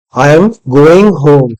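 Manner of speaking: slow line leaning on "going"; stress on GOING. Shown as a demonstration. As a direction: draw out and emphasize "going". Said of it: "Going means you are implying that".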